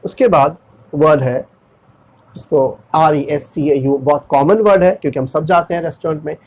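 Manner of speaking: ordinary delivery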